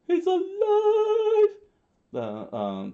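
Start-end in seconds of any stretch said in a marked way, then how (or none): 0.1-1.5 very high, loud, drawn-out scream; Sanji. A narrator's reading. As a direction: scream it slowly in total shock. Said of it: "Sanji screamed out".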